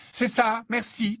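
mother snapping to end the talk; curt, cutting tone. Acted. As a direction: shut her down curtly. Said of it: "trancha Missus Weasley d'un ton cassant".

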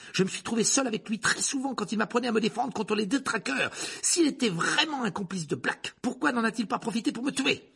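high, loud, urgent arguing; indignant protest. Acted. Boy defends his teacher, indignant. Harry argues with Rogue.

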